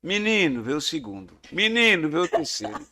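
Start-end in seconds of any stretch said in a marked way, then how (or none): 0.0-0.6 put-on loud rural call to a child; mother calling out. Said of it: "eram as entonações". 1.5-2.1 put-on loud rural call to a child; mother calling out. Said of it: "eram as entonações".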